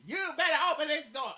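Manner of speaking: loud, high mock yell from outside; mock shout. Husband locked out, yelling at the door in a loud mock shout. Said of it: "and I wouldn't go".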